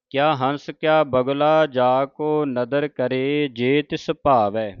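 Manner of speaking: measured, slow, devout reading of the verse; slow scripture recitation. Acted. Teacher reciting Farid's shalok to the sangat, slow and devout. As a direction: chant each phrase carefully with pauses.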